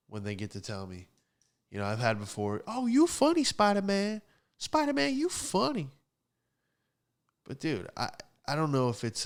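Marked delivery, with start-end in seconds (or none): 2.7-5.9 high, loud mock female voice; put-on high voice of an amused woman; teasing woman impression. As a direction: crow it in a high-pitched, delighted tease. Imitating women who tease him.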